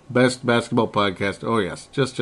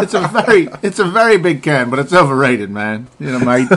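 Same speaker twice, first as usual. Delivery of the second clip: put-on loud, raised-pitch Australian accent; 5 dB louder than usual. Host joking about Foster's in a hammed-up Australian accent. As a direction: ham up an Australian bloke. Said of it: "add a speak Australian".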